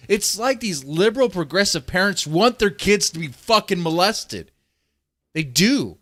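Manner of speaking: worked-up, high-pitched angry rant; heated, incredulous tirade; getting heated. Real, not acted. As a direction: rant in real outrage, voice climbing. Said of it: "I'm getting fucking heated".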